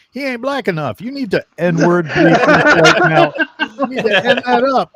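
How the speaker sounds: high, loud laughing buddy voice; friend's voice. Retold or imitated. Friend demands edgier character, high loud laughing voice. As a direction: ham up the pal's outrageous demand.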